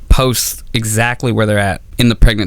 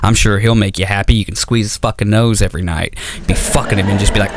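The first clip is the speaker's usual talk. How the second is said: usual speaking voice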